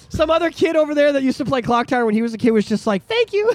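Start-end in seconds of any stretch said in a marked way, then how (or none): 3.1-3.5 put-on high kid voice.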